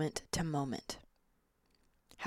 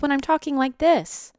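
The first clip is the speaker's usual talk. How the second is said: pitched way up and louder; high, hurried-sounding disembodied voice; raised, ungrounded voice; 9 semitones higher, 7 dB louder than usual. Shown as a demonstration. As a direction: jump the voice up high. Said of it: "if my voice sounds like this".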